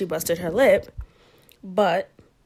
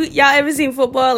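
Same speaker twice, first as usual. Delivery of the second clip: ordinary talk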